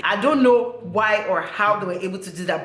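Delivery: ordinary talk, nothing marked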